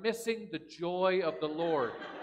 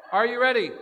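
normal talking voice

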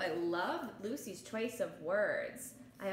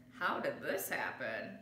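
deeper, gentle father; lowered papa voice; 9 semitones lower than usual. Acted. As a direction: ask like a concerned dad. Papa Gino asks.

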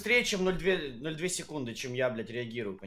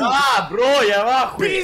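outraged shouting; 7 dB louder, much slower than usual. Real, not acted. Players outraged at next game.

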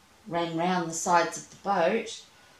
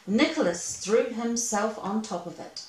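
usual speaking voice

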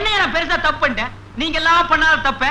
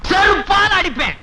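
higher, slow emphatic shout; angry threat; 4 semitones higher than usual. Acted. Husband threatens wife for defending her father, angry shout. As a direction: burst out with a scolding threat.

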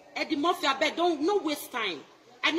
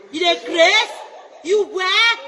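louder, laughing jab; loud mocking challenge; 2 semitones higher, 7 dB louder, slower than usual. Real, not acted. Taunting critics on social media, loud laugh. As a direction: throw it at them, loud and scornful.

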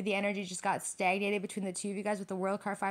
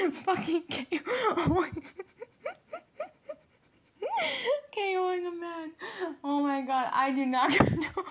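breaking up into helpless, high laughter; real laughter squeezing through the words; laughing; 9 semitones higher than usual. Real, not acted. Reader cracking up over women K O ing a man, laughing. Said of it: "I'm laughing because you're so stubborn".

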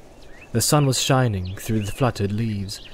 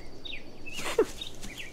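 laugh; 12 dB quieter than usual. A narrator's reading. Pippin laughing.

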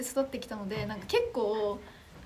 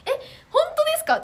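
high, loud burst of surprise; startled disbelief; 10 dB louder than usual. Real, not acted. Streamer reacting to a viewer's comment, startled. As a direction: blurt it out, voice leaping up.

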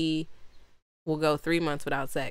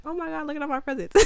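put-on excited kid; 9 semitones higher, 3 dB quieter, faster than usual. Kid on Christmas morning.

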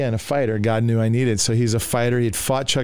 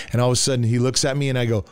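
ordinary talk, nothing marked

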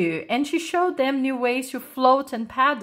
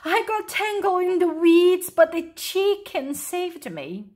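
high-pitched, excited account; breathlessly eager tale of a rescue; calling out; 8 semitones higher than usual. A narrator's reading. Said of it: "she called".

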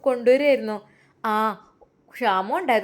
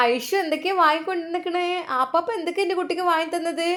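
doting grandma; 7 semitones higher, faster than usual. Acted. Grandma fussing over child.

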